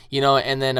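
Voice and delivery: ordinary talk, nothing marked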